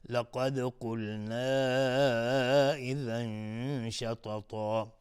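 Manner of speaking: "لقد قلنا" slow, d bounced, final aa held long; qalqalah and madd. Shown as a demonstration. Teacher models the rules, "لقد قلنا إذا شططا" slowly drawn out. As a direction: stretch "قلنا", then hum the n of "إذا" into "شططا".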